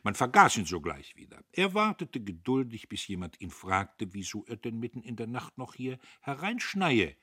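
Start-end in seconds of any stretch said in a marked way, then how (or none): none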